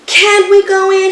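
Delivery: high, loud eager ask; eager question. A narrator's reading. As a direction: ask eagerly to get into the treehouse. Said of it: "he asked".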